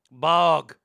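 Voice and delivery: loud Boston "Bog" with no R; Boston accent. Shown as a demonstration. Showing how they would say borg, loud Boston accent. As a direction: belt out "Bog", Boston style. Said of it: "That's how they would say it".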